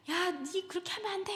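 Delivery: high-pitched mock reprimand; scolding parent. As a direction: act out a parent telling a child off, pitched high. Wrong example: parent scolding child.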